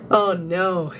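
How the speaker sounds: normal talking voice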